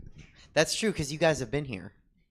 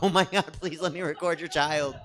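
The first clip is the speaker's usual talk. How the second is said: laughing; 6 semitones higher, 7 dB louder than usual. Retold or imitated. Laughing plea.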